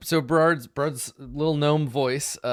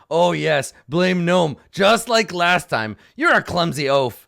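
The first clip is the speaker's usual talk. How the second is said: loud, higher scornful gnoll; sarcastic loud gnoll; second gnoll; 6 semitones higher, 9 dB louder than usual. Acted. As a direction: scold the clumsy partner. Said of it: "He's like".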